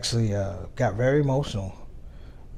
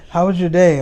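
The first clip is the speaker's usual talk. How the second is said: put-on child's voice asking again, louder and higher; a sweet little girl pressing for an answer; little daughter, louder; 7 semitones higher, 11 dB louder than usual. Daughter asks dad again.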